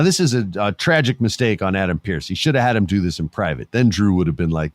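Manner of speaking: ordinary talk, nothing marked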